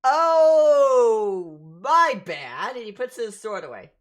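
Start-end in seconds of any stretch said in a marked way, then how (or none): 0.0-2.8 loud, high, stretched-out realization; game character's apology. Acted. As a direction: exclaim it big and theatrical. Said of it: "he goes".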